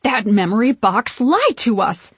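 loud, swooping indignation; betrayed witch's outburst; shaken outrage. A narrator's reading. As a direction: accuse the box, upset. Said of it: "voice shaking".

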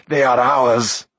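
loud, drawn-out gloating; triumphant cry. A narrator's reading. Mag gloating to Akron, triumphant cry. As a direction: crow over the prize. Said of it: "he cried".

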